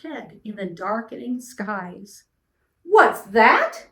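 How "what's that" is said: high, loud indignant demand; affronted question with a sharp rise; snorted question. A narrator's reading. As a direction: snort it in royal outrage. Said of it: "snorted Yertle".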